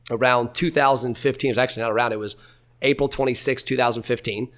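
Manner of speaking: ordinary talk, nothing marked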